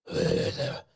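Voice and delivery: whispered, raspy superhero growl; Batman. Pretend. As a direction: rasp it slowly with no voice, like a dark vigilante. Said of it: "I'm Batman".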